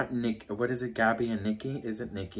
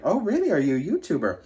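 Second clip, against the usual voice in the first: surprised retold question; 6 semitones higher, 4 dB louder, faster than usual. Retells her own surprised question.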